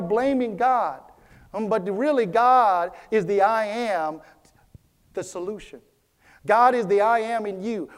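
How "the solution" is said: ordinary delivery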